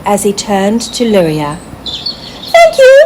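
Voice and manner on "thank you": high squirrel voice, loud and thankful; a happy little squirrel thanking his rescuer; high, glad squirrel voice; grateful squirrel. A narrator's reading. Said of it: "he said".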